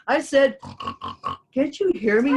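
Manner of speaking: loud, high shout at the crows; yelling. A narrator's reading. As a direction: yell to make them listen. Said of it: "he yelled".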